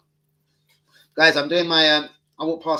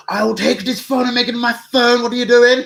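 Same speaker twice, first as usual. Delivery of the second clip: much higher and louder mock Luton lad; 12 semitones higher, 13 dB louder than usual. Threatening the fan who took a photo, in a high Luton voice.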